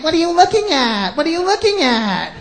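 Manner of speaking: hammed-up offended challenge; uptight women. Chicks catching you looking, mock uptight voice. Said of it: "And then they get uptight".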